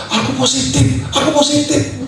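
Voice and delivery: high, soft squeal of news; hushed, high retold excitement; excited announcement. Wife announces positive test at night.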